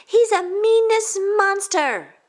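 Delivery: very high, loud, slow; outraged child. Acted. Tina calling Todd names to teacher. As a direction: spit out the insult.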